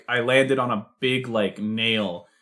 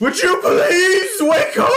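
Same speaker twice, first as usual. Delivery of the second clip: high, loud bird shout; shrieking bird; 6 dB louder than usual. Acted. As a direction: screech the plea like a nagging bird. Bird shouting to wake her.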